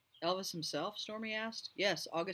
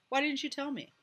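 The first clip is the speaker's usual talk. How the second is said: sharp, high, louder alarmed question; 11 semitones higher, 7 dB louder than usual. A narrator's reading. Stormy confronts narrator, alarmed and loud. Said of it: "This revelation alarmed Stormy".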